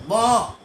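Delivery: short, loud mock moo of a cow. Teacher imitates a cow to his class, a loud playful moo. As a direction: bellow it once like a cow, playfully. Apa dia lembu?.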